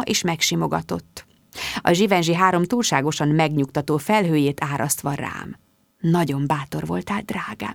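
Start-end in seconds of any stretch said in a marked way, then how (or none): 6.0-7.7 soft, hushed words of praise, dropping softer; whispered praise. Acted. Mum hugging her daughter, whispering praise. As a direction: breathe it tenderly, almost a whisper. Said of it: "súgta".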